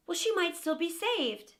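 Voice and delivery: ordinary delivery, nothing marked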